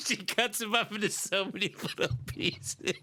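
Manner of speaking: talking through real laughter; laughing. Real, not acted. Breaks up at own joke.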